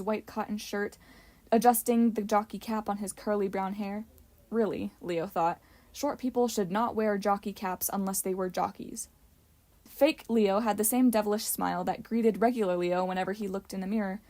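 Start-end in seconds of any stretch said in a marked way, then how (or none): none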